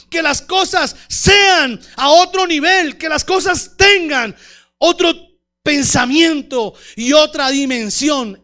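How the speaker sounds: loud, fervent sermon build; preaching at full force. Acted. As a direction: proclaim it to the whole church. Predica a toda voz. (predicación a viva voz, más alta y fuerte)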